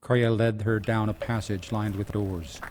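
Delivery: usual speaking voice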